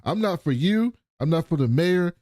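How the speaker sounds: high-pitched mock indignation; acted indignant rebuff. Retelling a lawman refusing thanks, indignant.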